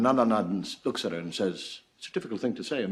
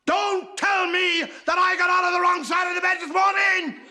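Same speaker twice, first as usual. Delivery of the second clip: high, loud furious outburst; nun snapping at the Mother Superior; livid nun; 6 dB louder than usual. Acted. Fed-up nun shouting at her superior. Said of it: "by then she's livid".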